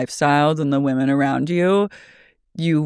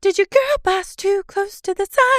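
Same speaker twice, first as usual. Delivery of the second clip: very high mocking question. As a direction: tease her in a squeak.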